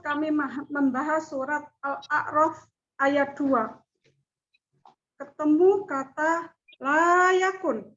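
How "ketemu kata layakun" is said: ordinary delivery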